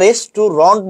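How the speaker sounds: "রেস্টুরেন্ট" slowly as res-tu-RONT; slow "রেস্টুরেন্ট" with a rounded last vowel; corrected ending RONT. Shown as a demonstration. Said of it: "এটা রেস্টুরেন্ট বলছি".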